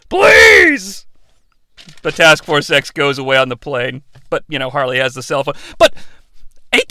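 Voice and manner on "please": high, loud begging; desperate plea. Retold or imitated. Guard begging Harley Quinn to put in a good word, loud. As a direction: beg her loudly and high. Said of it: "he's like".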